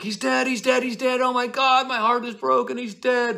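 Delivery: shrieking high and loud, wailing "he's dead"; hysterical nurse. Acted. Nurse bursting in to Juliet, shrieking in a hammed-up panic. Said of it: "she's like".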